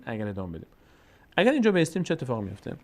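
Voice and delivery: normal talking voice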